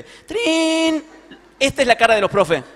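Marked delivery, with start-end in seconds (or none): 0.0-1.0 high, loud imitation of a ringing bell; school bell ring. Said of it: "sonó el timbre".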